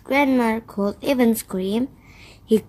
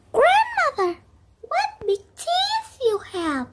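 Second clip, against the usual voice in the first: put-on much higher innocent girl; little girl voice. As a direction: ask wide-eyed like a small girl. Girl speaking to disguised wolf.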